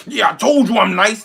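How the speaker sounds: high, loud cocky boast; mock bragging. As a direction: play the smug winner gloating. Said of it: "and you're like".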